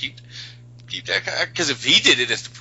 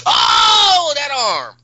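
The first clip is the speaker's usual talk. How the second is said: loud, high cry of horrified amazement; shocked outcry; 12 semitones higher, 7 dB louder, much slower than usual. Real, not acted. Fan reacting to a broken arm, shocked outcry. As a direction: yell it out, stretching the Oh.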